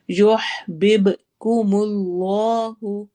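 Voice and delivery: یحببکم Allah with the ح held in the throat and the ب bouncing; throaty ha, echoing ba. Shown as a demonstration. Teacher demonstrating qalqala in یحببکم, slow. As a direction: hold the ح in the throat, echo the ب. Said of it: "ح کی آواز ٹھہرائیں گے حلق میں".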